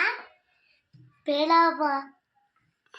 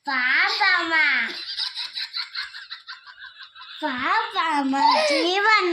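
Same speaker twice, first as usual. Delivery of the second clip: louder laughing call across the room; 7 dB louder, much slower than usual. Real, not acted. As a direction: shout the names with a laugh. Child calling out to friends.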